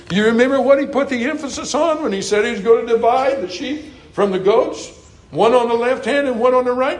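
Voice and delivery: high sermon cadence with rising phrase ends; lifted, rhythmic pulpit recitation; fervent preaching. Real, not acted. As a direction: preach it as an insistent reminder to the congregation. Preacher recalling scripture, rising cadence.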